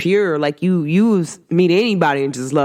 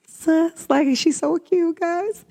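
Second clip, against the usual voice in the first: high squeal; 11 semitones higher, slower than usual. Real, not acted. Gushing about sister.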